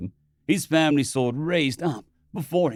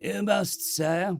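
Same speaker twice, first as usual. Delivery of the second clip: begging; 6 semitones higher, much slower than usual. Acted. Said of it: "the courier behind him begged".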